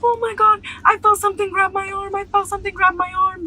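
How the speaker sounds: high panicked boy voice, imitating; mock a boy bawling in fear; imitating crying boy. Crying boy tells the girls something grabbed him, high panicked imitation. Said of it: "he's dead ass crying".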